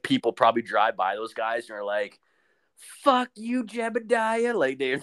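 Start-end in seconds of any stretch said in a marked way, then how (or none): 2.8-4.5 high mock taunt at a passerby; jeering driver. Driver yelling at Mennonite boys, high mocking taunt. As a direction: taunt him like a rude passing driver.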